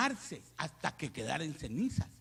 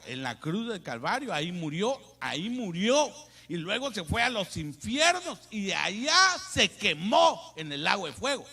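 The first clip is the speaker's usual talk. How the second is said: louder, rising preacher's cadence; preaching build; 4 semitones higher, 8 dB louder than usual. Acted. Preacher on the cross and the fire, louder building cadence. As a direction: build it up line by line.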